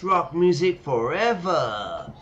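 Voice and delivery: high slow proclaiming; rock anthem. Acted. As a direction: declare it like a rally cry. Playing the future man.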